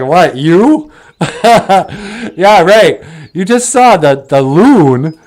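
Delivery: jeering friends, laughing as they talk; mocking laughter. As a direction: laugh them down in disbelief. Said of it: "everyone starts laughing".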